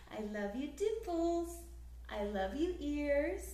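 usual speaking voice

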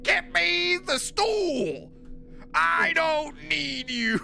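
high, slow mock tiny-grandma shriek; imitating angry grandma. Tiny grandma snapping at husband over the shelf, mock shriek. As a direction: squeal it like a furious little old lady. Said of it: "She's like".

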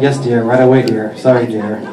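low, submissive yes-dear voice; meek husband. Acted. Henpecked Santa obeying his wife, low meek apology. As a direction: drop low and give in meekly. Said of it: "Actually, it was more like".

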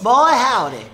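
Southern accent. Shown as a demonstration. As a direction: bellow it like a cowboy. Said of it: "Try that".

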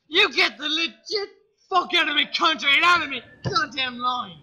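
hammed-up guard screaming at a traveller; furious shout. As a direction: throw him out of your country at full voice. Angry border guard ejects applicant.

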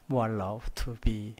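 ordinary talk

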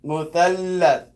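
"Muthallath" slow and loud on a long l; held lam. Shown as a demonstration. Teacher repeating after the learner, held l in "Muthallath". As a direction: hold the middle l in "Muthallath". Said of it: "you hold the lam".

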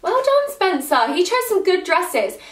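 sudden high, excited praise, then slowing down; delighted praise. Real, not acted. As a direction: gush over his good taste. Praising fiancé's dress choice.

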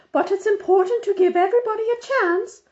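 high, loud bossy lady's voice; fussy Mrs Armstrong. A narrator's reading. Busybody on the phone lecturing Mother, high and loud. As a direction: play the busybody organizer insisting.

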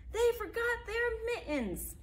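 high, slow rumbling grumble; grumbling bus driver. Acted. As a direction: complain like a fed-up driver. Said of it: "mumbled and grumbled and rumbled".

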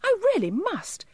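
very high, insistent elf; insist, upset, in a tiny high voice; high-pitched little plea; upset cry. A narrator's reading. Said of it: "quite upset".

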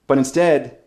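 ordinary talk, nothing marked

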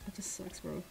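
breathy whispered grumble to herself; dejected whisper. Real, not acted. Streamer muttering over no crystals, whispered. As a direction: whisper it, deflated and defeated.

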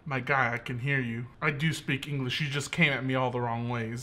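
very low, soft, deadpan answer; unimpressed listener reply. Acted. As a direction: answer the yeller quietly and low. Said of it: "It's like".

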